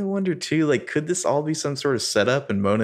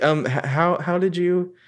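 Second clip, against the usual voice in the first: halting, higher-pitched imitation of annoyance; mildly annoyed; 10 semitones higher, slower than usual. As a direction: stammer it with a touch of annoyance. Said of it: "And she kinda gets annoyed".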